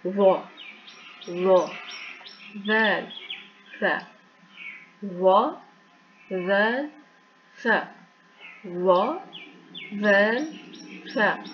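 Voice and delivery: वा thick with breath, वैल thin; letter drill. Shown as a demonstration. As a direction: puff air on वा, keep वैल thin. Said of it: "तो ये word जो है वो अदा होगा".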